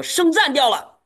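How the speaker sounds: sudden high alarmed shout; a sharp cry of alarm across the field; loud shout. Retold or imitated. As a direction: yell it out in alarm. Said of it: "他就大喊了一声说".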